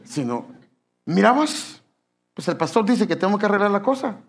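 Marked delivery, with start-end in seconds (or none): 1.1-4.2 confronting in-law. Acted. Mother-in-law confronts daughter-in-law.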